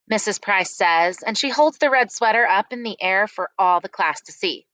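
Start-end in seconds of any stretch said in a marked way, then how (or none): none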